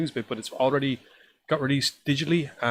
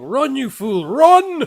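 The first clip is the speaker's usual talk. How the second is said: rising panicked scream, much louder and higher; 11 semitones higher, 11 dB louder, much slower than usual. Pretend. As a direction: yell it as a frantic warning. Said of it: "me screaming".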